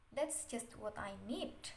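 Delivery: ordinary talk, nothing marked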